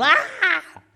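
very high, hammed-up scream; mock schoolgirl shriek. Preacher mocking sissy schoolgirls, mock shriek. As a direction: squeal in mock terror. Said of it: "sissy schoolgirls going".

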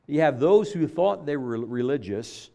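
normal talking voice

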